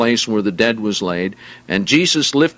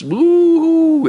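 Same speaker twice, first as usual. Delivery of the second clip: high, loud mock wail of grieving mourners; mock mourning wail; 4 dB louder than usual. Preacher imitating Israeli funeral mourners with a mock high wail.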